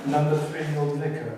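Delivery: punchline broken up by laughter; can't get through it for laughing. A narrator's reading.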